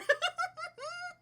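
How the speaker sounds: shrieking high giggle; high laugh. Real, not acted. Streamer reading a dog name list, squealing laugh.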